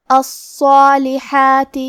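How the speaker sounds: الصالحات very slow, heavy emphatic ص and long heavy alif; tajweed demo. Shown as a demonstration. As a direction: draw out الصالحات with a thick, full ص. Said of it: "ہمیں مد الف کو بھی بھاری آواز میں ادا کرنا". (الصالحات بہت آہستہ، صاد اور مد الف بھاری آواز میں)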